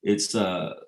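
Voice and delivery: ordinary talk, nothing marked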